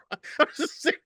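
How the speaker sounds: laughing through the words, high. Real, not acted. Laughing while retelling the lonely beach trip. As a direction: giggle it out, half breathless.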